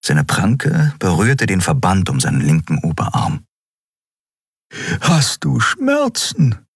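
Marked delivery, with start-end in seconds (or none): 5.0-6.7 high, slow, drawn-out question; concerned female character. A narrator's reading. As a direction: voice a worried woman, pitched high. Woman asks the wounded pilot.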